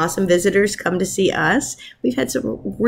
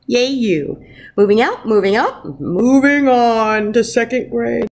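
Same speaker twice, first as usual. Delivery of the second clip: lively picture-book read-aloud; reading to children; 3 semitones higher than usual. Acted. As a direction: read it like storytime for your class. Teacher reads picture book aloud.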